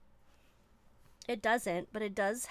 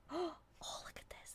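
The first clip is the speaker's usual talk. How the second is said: a gasp, then a voiceless whisper; awed whisper; 8 semitones higher, 9 dB quieter than usual. Real, not acted. Marvelling at the ink under the lens, in an awed whisper. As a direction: hush it in wonder.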